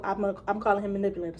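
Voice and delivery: normal talking voice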